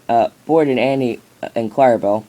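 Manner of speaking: usual speaking voice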